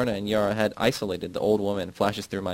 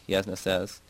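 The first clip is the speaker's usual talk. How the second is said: ordinary delivery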